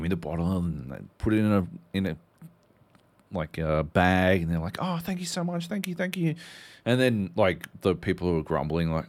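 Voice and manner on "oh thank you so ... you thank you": high, soft, gushing gratitude; fawning shopkeeper thanks. Retold or imitated. Staff thanking paying customer.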